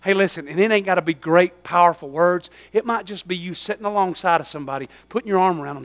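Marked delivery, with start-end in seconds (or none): none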